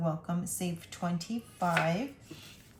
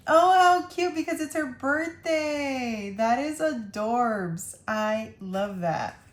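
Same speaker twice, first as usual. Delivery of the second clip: high, much louder squeal of delight; delighted gush; 3 semitones higher, 6 dB louder than usual. Real, not acted. Delighted by birthday card.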